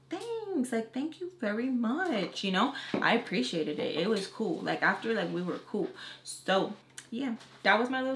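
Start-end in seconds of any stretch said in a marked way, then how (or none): 0.1-2.4 very high, soft, sing-song gratitude; cutesy retold thanks. Makeup artist thanks cousin for extra pay, cutesy. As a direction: replay a sweet thank-you, drawn out and high.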